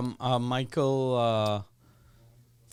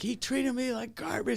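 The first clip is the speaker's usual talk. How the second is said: mock complaining woman; 5 semitones higher, 7 dB quieter than usual. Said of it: "girls that would have been like".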